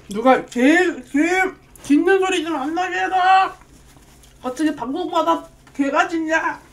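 hammed-up angry neighbor yelling; mock scolding shout. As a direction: read it like a grumpy scolding. Reading a viewer's scolding comment.

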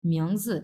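মিয়ংজি with জি touched and released; light neutral ending. Shown as a demonstration. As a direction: let জি go at once. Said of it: "জিটা ধরেই ছেড়ে দেবো".

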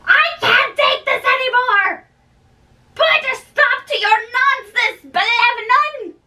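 high, theatrical plea to end the nonsense; exasperated outburst. Acted. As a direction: throw up your hands, begging the joking to stop. Friend exasperated by the joking.

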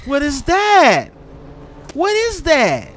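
high, loud, drawn-out yelp; real startled outcry, voice jumping up; startled alarm. Real, not acted. Player startled by something in the game, alarmed.

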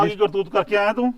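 angry scolding. Acted. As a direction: tear into the lazy worker. Master scolding farmhand.

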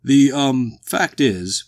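loud, hesitant boom; booming walrus. A narrator's reading. The walrus explains himself to strangers, hesitant boom. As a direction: boom it out, faltering for words. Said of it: "boomed the walrus".

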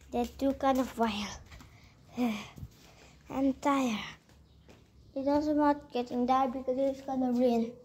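usual speaking voice

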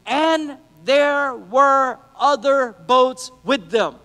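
"And there were other boats with them" spelled out word by word, very slowly; "And there were other boats with them" in drawn-out, separate beats; emphatic slow reading. Shown as a demonstration. Underlined verse reread with weight.

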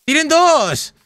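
high, excited discovery; thrilled, spotting something. Real, not acted. Spots two iron golems excitedly.

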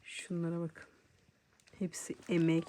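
ordinary talk, nothing marked

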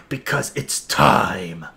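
low, slow, ominous build-up; dramatic announcement. Acted. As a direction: draw it out as a grand moment. Dramatic build-up before playing trailer.

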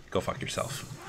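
whispered, mock spiteful comeback; hushed retort. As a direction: imitate a dismissive retort under the breath. Teacher's silent retort, retold.

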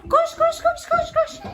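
high urgent run cries; being chased. Pretend. Fleeing the pretend dragon.

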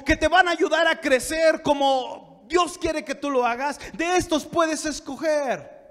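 high, loud pulpit teaching; forceful preaching. Real, not acted. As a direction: drive each point home to the congregation. (prédica enérgica, aguda y fuerte)

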